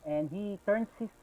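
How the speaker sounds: ordinary delivery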